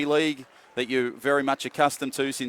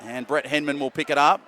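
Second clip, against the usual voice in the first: brisk, lively match call; live play-by-play; 3 semitones higher, 4 dB louder than usual. Real, not acted. Commentator calling the match live, brisk play-by-play. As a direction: keep the call moving with the play.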